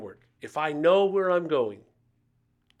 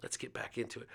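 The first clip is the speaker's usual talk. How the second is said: hushed and barely voiced, much softer; gentle whisper; 3 semitones lower, 10 dB quieter, faster than usual. Jesus inviting Peter back after the betrayal, whispered softly. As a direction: whisper it close, as if only Peter should hear.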